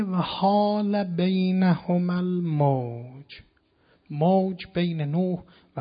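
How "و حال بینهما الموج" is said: very slow, drawn-out intoned verse; slow Quran recitation. Acted. Preacher reciting the verse about Noah's son, drawn out. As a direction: stretch each word of «و حال بینهما الموج» like chanting scripture.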